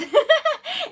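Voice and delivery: high rising giggle; squealing laugh. Real, not acted. Laughing at own pun.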